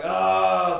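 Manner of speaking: high, loud drawn-out "oh"; exasperated groan. Retold or imitated. Annoyed man reacts to perky date, exasperated groan. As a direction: groan loudly in mock exasperation.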